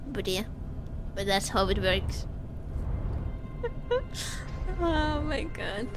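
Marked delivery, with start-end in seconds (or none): 2.3-4.8 soft, high breath of laughter; amused laugh. Real, not acted. Streamer amused by the game, soft laughing. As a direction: laugh softly and helplessly.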